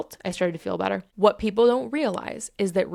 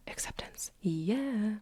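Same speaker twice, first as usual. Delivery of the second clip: soft, hushed whisper; barely voiced, whispered affirmation; whispered aside; 9 dB quieter, slower than usual. Real, not acted. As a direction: let it out under your breath. Breathed aside closing the point.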